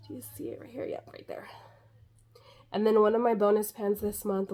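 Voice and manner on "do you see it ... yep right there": whisper. Real, not acted. Quiet pointing out pan.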